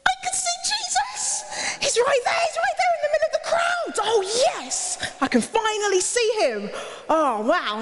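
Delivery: high, breathless cry of joy; thrilled shout. Acted. Zacchaeus up the tree spotting Jesus, high excited shout. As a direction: shout it out like a kid who finally sees.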